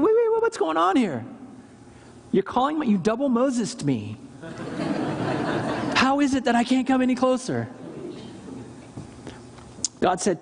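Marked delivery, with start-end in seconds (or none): 0.0-4.2 high, bewildered outburst in a put-on voice; startled Moses. Preacher voicing Moses' confusion at God's command, bewildered. 4.4-5.9 light laugh. Real, not acted. Laughs at own joke. 5.9-7.7 laughing question. Retold or imitated. Moses confused, laughing.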